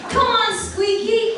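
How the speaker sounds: high, loud, slow piggy squeal of a voice; Oinky the pig, chirpy and shrill-pitched, egging his brother on; Oinky oinking. A narrator's reading. Pig urging lazy brother up, high loud piggy voice. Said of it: "Oinky oinked".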